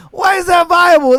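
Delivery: loud, high, disbelieving why; shouted repeat of the question; incredulous shout. Real, not acted. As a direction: yell it at them in disbelief.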